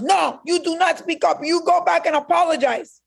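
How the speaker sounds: high, firm imitation of mother; strict mother. Mother ordering her daughter to apologize, high and firm. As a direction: lay down the law as mom. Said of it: "my mother goes".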